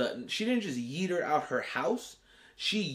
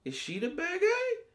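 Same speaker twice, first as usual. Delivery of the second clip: shocked realization; 10 semitones higher, slower than usual. Retold or imitated. Fan realizing Wanda may be the villain, high disbelieving cry. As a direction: gasp it out and shoot up in disbelief. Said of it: "And I was like".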